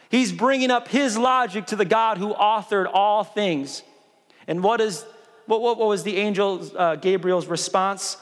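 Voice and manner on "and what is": usual speaking voice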